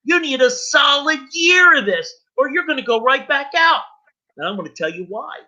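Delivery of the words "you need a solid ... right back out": high-pitched, emphatic warning to newcomers; impassioned recovery preaching. Real, not acted. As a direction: drive the hard truth home, insistently. Exhorts audience directly.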